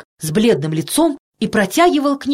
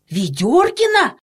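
drawn-out, higher-pitched question; startled cry; 6 semitones higher, slower than usual. A narrator's reading. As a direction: cry out the name in alarm, stretching it. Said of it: "закричал детский доктор". (удивлённый протяжный вскрик)

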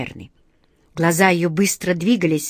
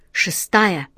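usual speaking voice

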